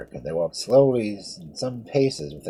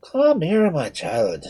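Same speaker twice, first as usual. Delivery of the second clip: kindly priest; 5 semitones higher, 5 dB louder, slower than usual. A narrator's reading. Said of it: "said the father".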